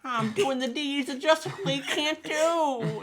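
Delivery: high cartoon voice. Plastic Man proclaiming justice, high and slow. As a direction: declare it like a goofy superhero. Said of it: "He's like".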